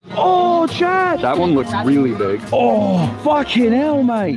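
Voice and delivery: loud, high whoops of amazement; excited shouting. Real, not acted. Friend yelling at a photo on screen, real excited shout. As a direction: blurt it out, thrilled by the view. Reacting to big travel photo.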